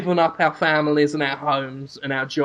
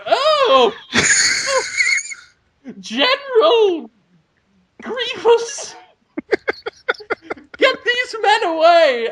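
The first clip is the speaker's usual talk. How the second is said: very high, horrified mock lady; hammed-up shriek of a posh lady calling for help; slower than usual. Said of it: "And she'll go".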